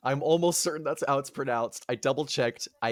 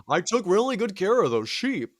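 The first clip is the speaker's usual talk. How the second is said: higher-pitched comic lament; aggrieved whine; 7 semitones higher, 4 dB louder than usual. Retold or imitated. Cyclops mourning his tended sheep, mock-hurt whine. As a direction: complain like a hurt owner.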